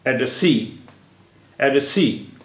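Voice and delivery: Address सी with सी strongest, first syllable lighter; primary stress on सी. Shown as a demonstration. Teacher demonstrating primary and secondary stress, सी stressed most in address सी. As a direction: lean hardest on the final सी in address सी. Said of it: "सी पर ज़्यादा जोर दिया".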